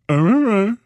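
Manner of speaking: high, goofy dog voice; dog's voice. Retold or imitated. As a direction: play the dog, high and silly. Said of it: "This is what he wrote".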